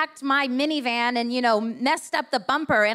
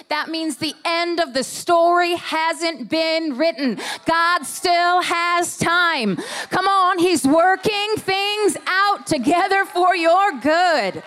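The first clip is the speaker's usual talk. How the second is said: louder, higher, slow rising preach; preaching at full force; 5 semitones higher, 6 dB louder, slower than usual. Acted. Pastor rousing the congregation toward God's timing, preaching full out. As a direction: build it up and drive it home.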